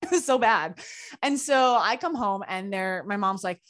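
normal talking voice

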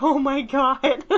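slow, drawn-out shocked "oh my God" with a laugh; mock shocked gasp. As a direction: play a horrified onlooker, half laughing.

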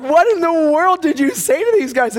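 high, loud parental disbelief; acted alarmed outburst. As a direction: play an aghast dad demanding answers. Parent confronting son, retold.

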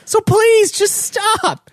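pleading yell to stop; begging the talkers to stop; exasperated plea. Pretend.

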